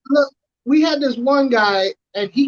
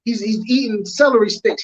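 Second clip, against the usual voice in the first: ordinary delivery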